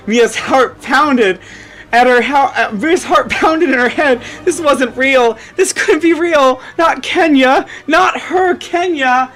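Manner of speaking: usual speaking voice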